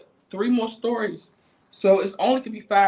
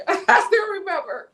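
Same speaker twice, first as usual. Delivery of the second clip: laughs while remembering her, higher and louder; laughing; 12 semitones higher, 6 dB louder than usual. Real, not acted. Recalling the girl she punched, said through a laugh. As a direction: chuckle through the memory.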